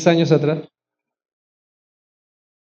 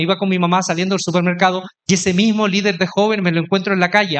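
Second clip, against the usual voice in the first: usual speaking voice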